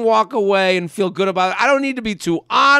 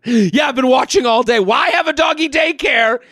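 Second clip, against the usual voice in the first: indignant complaint; 3 semitones higher than usual. Acted. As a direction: raise your voice in mock outrage at the daycare. Reading an exasperated customer's letter aloud.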